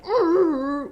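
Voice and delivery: high, loud dismissive "urgh"; scornful grunt. Retold or imitated. Young girls scoffing at woman in parking lot, high scornful grunt. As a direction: scoff like a rude teen.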